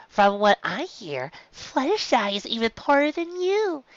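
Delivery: looking down her nose at a poorer filly; snide filly. Acted. Silver Spoon mocks Dinky about Fluttershy, slow and sneering. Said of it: "Silver Spoon interrupted".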